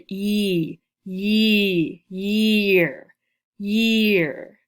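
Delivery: YEAR with a loud, drawn-out "E" vowel; long E. Shown as a demonstration. Teacher shows the vowel in year, long E stretched. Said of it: "you hear the long E sound".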